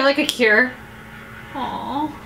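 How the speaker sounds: usual speaking voice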